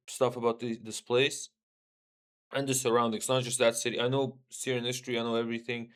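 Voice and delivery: normal talking voice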